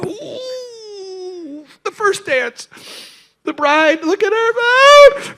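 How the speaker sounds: high, teary crying at the first dance; mock weeping. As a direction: cry like a baby at the wedding. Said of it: "weeping like a baby".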